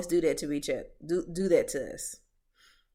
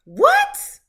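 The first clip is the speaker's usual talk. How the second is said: sharp, loud and much higher outcry; incredulous what; 10 dB louder than usual. Real, not acted. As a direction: disbelief bursting out. Reacting to a mother's "stop all that noise.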